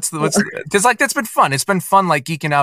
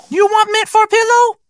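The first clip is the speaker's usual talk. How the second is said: high falsetto maid voice; 4 dB louder than usual. Retold or imitated. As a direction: squeak it out as the fake maid. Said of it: "he says".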